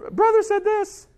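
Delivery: put-on whiny little kid telling on someone; tattling kid. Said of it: "or Dad".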